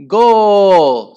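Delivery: high, very loud, drawn-out goal cry; scream. A narrator's reading. Henry celebrating his handball goal, screaming. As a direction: scream it in triumph. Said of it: "screamed Henry".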